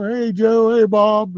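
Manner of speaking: high, cheery hellos to the regulars; friendly greeting. Retold or imitated. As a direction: wave and call out to each one by name.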